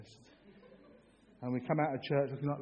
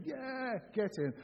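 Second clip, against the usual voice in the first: mock cheer; 8 semitones higher, 4 dB quieter, slower than usual. Shown as a demonstration. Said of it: "you can go".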